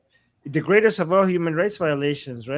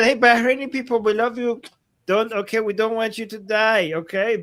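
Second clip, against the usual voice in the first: playful reassurance; 6 semitones higher than usual. Pretend. Mock address to Bahraini people.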